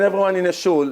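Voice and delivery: usual speaking voice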